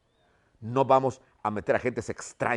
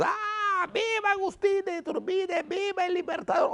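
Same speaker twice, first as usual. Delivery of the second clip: much higher, strained crowd cheer, hammed up. Teacher voicing townspeople hailing Iturbide, high-pitched mock cheer. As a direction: squeal it like an ecstatic townsfolk crowd.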